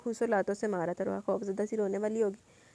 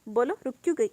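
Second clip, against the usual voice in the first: stony tone; 3 semitones higher, 7 dB louder than usual. Acted. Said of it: "وہ پتھریلے لہجے میں بولا".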